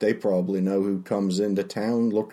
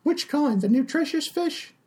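higher chatty tavern barmaid. Acted. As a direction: play the friendly, simple barmaid. GM voices Tallulah the barmaid.

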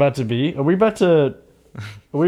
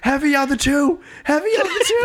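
shrill coach call; 13 semitones higher than usual. Retold or imitated. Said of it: "cornerman bit".

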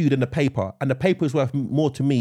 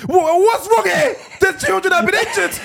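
mock outrage; 4 dB louder than usual. Pretend customer scolding the insurance agent, in hammed-up outrage. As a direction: play the wronged parent, pitched way up in fake shock.

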